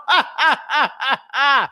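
a short mocking laugh, high; mocking laugh of triumph; gloating laugh. Real, not acted. Gloating after the threat.

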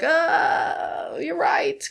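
high, softened concession; mock realization. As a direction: draw out a high, sheepish admission. Acting out conceding to blame.

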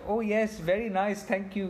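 amused Indian-accent impression; imitated Indian accent. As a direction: tease a friend's accidental Indian accent. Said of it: "speak to them in an Indian".

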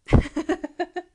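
bursting loud laugh. Real, not acted. Narrator cracking up at the cheesy ending, loud laugh.

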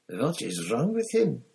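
worried question in a hushed scene; Krum, alarmed. A narrator's reading.

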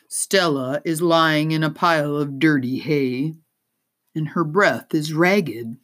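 ordinary delivery

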